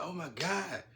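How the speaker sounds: high, loud burst of relief; relieved exclaim. Retold or imitated. The girl in the hotel seeing her rescuer, excited relief. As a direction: gasp it out, glad he's here. Woman relieved to see him.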